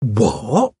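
drawn-out, incredulous question; dumbfounded. A narrator's reading. Secretary hearing of the confession, dumbfounded. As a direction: react in astonishment. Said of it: "The girl was clearly dumbfounded".

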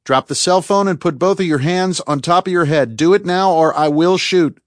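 high, forceful shouted orders; loud ultimatum at gunpoint; yelled command. A narrator's reading. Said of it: "he yelled".

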